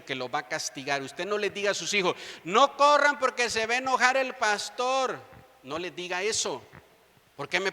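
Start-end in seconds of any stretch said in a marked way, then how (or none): 2.4-5.2 put-on higher, louder scare-the-kids warning; mock parental warning. Parent warning children about the pastor, mock alarm. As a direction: call out a hammed-up warning to the children.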